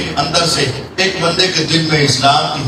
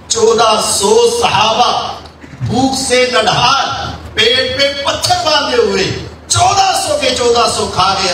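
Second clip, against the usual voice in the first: impassioned marvelling; 7 semitones higher than usual. Real, not acted. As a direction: stretch each phrase high in rising wonder. Preacher marvels at miracle's climax.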